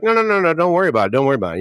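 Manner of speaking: high, fast-talking fake reassurance; smooth-talk the frog into trusting you; mock reassuring scorpion. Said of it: "the scorpion's like".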